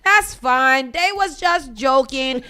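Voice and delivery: slow, drawn-out, loud brush-off; exaggerated, stretched-out reassurance in mockery; mocking dismissiveness. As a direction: imitate officials waving away a scandal. Mimics party leaders brushing off scandal.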